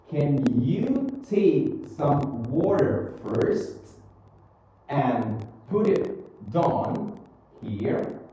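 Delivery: very slow, word by word for repeating; slow model reading. Shown as a demonstration. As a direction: draw it out so learners can follow. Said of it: "read after me".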